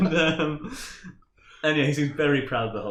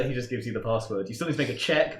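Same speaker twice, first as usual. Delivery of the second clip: usual speaking voice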